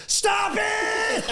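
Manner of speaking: high shrieking yell through laughter; mock shout of an angry boy; furious kid shout. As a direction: blow up like a fed-up little boy. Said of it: "Not exaggerating the reaction".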